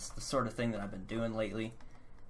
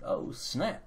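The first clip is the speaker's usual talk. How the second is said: sassy; 4 semitones higher than usual. Pretend. Sassy exclamation.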